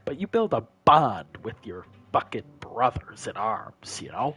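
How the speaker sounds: low, solemn brothers-in-arms speech; veteran's low war-story voice; army veteran storyteller. Acted. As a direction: tell it haunted, with long pauses.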